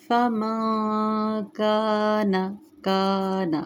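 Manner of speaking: slow melodic tajweed chant; Quran recitation. Shown as a demonstration. Recites phrase.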